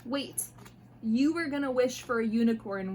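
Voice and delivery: ordinary delivery, nothing marked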